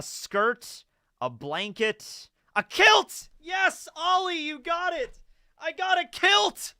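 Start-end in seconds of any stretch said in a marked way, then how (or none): 2.5-6.7 excited. Real, not acted. Guessing game answer, excited.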